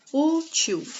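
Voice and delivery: "Útil" with a high, held Ú; stressed first syllable. Shown as a demonstration. Teacher showing the tonic syllable, "Útil" broken after a high Ú. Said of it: "O U é a sílaba tônica".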